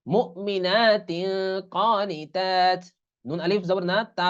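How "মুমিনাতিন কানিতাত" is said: মুমিনাতিন very slow with nasal তিং before কা; slow recitation. Shown as a demonstration. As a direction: stretch each syllable. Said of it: "এরকম উচ্চারণ হবে।". (ধীরে তিলাওয়াত, গুন্নাসহ)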